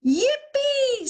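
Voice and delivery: high, loud yippee; a little crayon's high whoop; excited cheer. Acted. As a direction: squeal with joy. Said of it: "says the green crayon".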